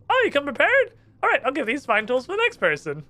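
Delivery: chirpy surprised underwater stranger; squeaky NPC. Acted. Trapped islander greets the first visitor, high squeaky.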